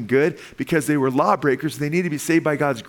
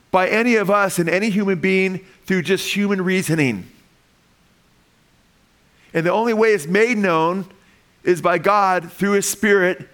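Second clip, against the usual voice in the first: fervent preaching; 5 semitones higher than usual. Acted. Pastor urging the church that God reveals the mystery, fervent preaching. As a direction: drive home that the mystery is beyond reason.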